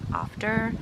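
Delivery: "after" with the R pronounced at the end; rhotic R demo. Shown as a demonstration. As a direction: sound the R in "after". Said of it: "we do not pronounce R's".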